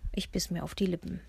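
usual speaking voice

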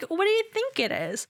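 pitched well up, incredulous; mock exasperated retort. Fast-food worker snarking at a customer about the water, in mock disbelief. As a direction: ask it like the answer's obvious.

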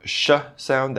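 a long hissed sh, held alone; sh sound. Shown as a demonstration. Teacher modelling the sh sound for learners. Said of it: "If you don't have the".

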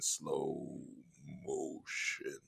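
drawn out low and soft; slow motion. Retold or imitated. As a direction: stretch the words out as if slowed down.

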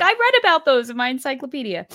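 put-on high, loud, fast and giddy, like a proud kid; gleeful nerdy brag. Recalling her own thrill at knowing the boomerangs, giddy and fast. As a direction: blurt it out with nerdy delight. Gushing about her research notes.

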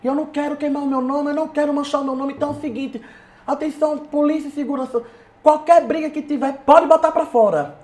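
high, level-toned stage warning, re-enacted; retold microphone announcement. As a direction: address the crowd as if on the mic. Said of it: "eu no microfone, eu falei bem assim, ó".

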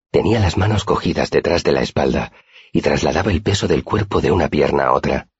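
ordinary delivery, nothing marked

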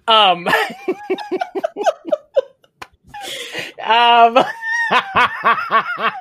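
real burst of high laughter between words; laughing. Real, not acted. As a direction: crack up at the memory. Laughing at own costume story.

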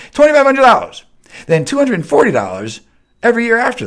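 high, loud exclamation of the price; outraged shout. Real, not acted. Host ranting at listeners about website cost, outraged shout. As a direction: yell the number in disbelief.

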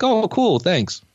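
high-pitched polite thanks, falling away; trying to be nice about it; politely cordial. Retold or imitated. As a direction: feign cordial appreciation of a gift. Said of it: "Trying to be cordial about it".

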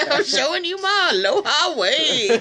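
high, loud, laughing lady voice; mock flirty lady. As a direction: camp it up, laughing.

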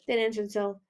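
ordinary delivery

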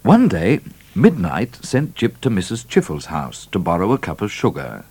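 ordinary delivery, nothing marked

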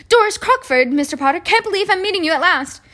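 high, loud, quick gushing; excited admirer. A narrator's reading. Doris Crockford finally meeting Mister Potter, excited gushing. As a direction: gush in a high-pitched rush. Admirer meets Harry at last.

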